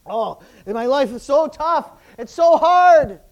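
hammed-up whining complaint, slow and loud; mock a self-pitying complainer, dragging it out; mocking whiner.

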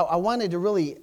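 ordinary delivery

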